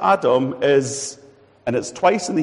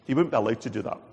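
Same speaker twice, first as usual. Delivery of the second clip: ordinary talk, nothing marked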